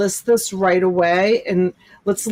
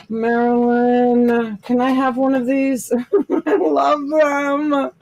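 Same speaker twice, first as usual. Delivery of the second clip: slow, high wheedling beg; joking, drawn-out begging; mock pleading; 4 semitones higher than usual. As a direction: draw it out like a playful plea, pitched up. Joking plea, then says she's joking.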